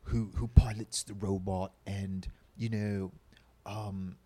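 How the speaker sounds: slow mock bland American accent; bad American accent. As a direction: keep the fake generic American drawl going. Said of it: "His horrible American accent".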